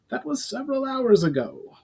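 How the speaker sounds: high goblin voice; a wary goblin reporting. A narrator's reading. Brawl the goblin speaks.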